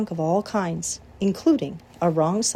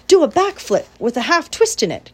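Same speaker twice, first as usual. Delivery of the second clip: high, loud command; crying out; 8 semitones higher, 7 dB louder than usual. A narrator's reading. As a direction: demand the trick with energy. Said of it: "cried Charlotte".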